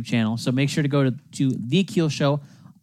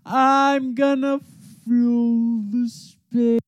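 silly high voice; 13 semitones higher, 6 dB louder, slower than usual. Acted. Stalling while brother preps sign-off.

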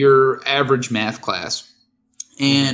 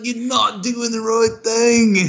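hammed-up Australian accent, slow and higher; Steve Irwin; 6 semitones higher, slower than usual. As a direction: do a bad, drawn-out Aussie impression. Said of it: "the worst Steve Irwin I've ever heard".

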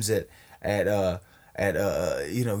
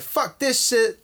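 much higher mock exasperated [name]; 12 semitones higher, slower than usual. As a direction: toss it off, fed up and squeaky. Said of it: "He's like".

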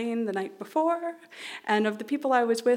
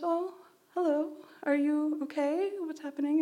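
lightly voiced gentle woman, higher and softer; a hint of a caring stranger leaning in; kind stranger; 5 semitones higher, 5 dB quieter than usual. Helpful woman asking a crying teen, gently voiced.

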